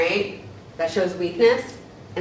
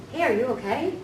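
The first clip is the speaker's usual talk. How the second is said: concerned check-in; 5 semitones higher than usual. Retold or imitated. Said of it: "people who say".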